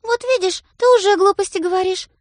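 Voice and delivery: high, loud reproach; scolding sister. A narrator's reading. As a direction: scold him for nonsense. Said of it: "замечает сестра".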